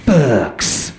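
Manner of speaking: punchy slogan read, word by word; mock dramatic network announcer. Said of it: "whenever the announcer says the name".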